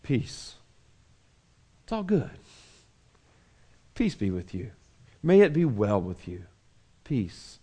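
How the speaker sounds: soft, low, tender peace; soothing hush. Acted. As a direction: hush them gently like a calming steward. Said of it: "He literally says in their native Hebrew".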